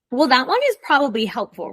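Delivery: normal talking voice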